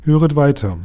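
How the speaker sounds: a mysterious voice commanding attention; powerful command. A narrator's reading. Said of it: "tönte die geheimnisvolle Stimme machtvoll". (machtvoll und geheimnisvoll)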